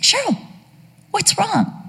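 put-on high, concerned old mom; lightly voiced anxious mom; worried old mother.

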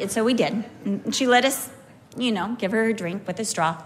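usual speaking voice

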